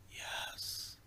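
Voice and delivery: a long, breathy, drawn-out yes; the ghostly woman's voice from the recording, drawn out in a whisper. Retold or imitated. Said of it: "And it was drawn out like that".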